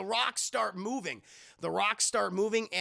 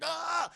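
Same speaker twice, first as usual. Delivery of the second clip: high mock yell of pain; drawn-out "ah" as if pelted; pained cry; 11 semitones higher than usual. Imitates fighter hit by rocks.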